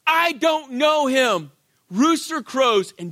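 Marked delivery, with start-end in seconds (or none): 0.0-1.5 loud, high, slow acted denial; forceful, drawn-out declaration; emphatic. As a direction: hammer out each word of the denial. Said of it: "you come out emphatically".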